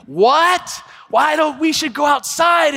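mock shout to spouse; shouting over noise. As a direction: speak up over a noisy crowd. Shouting over mall crowd to spouse.

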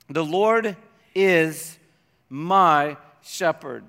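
The Lord is my shepherd, slow, weight on Lord; slow solemn reading. Shown as a demonstration. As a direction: read it slowly, savoring each word. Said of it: "Can we say that part together?".

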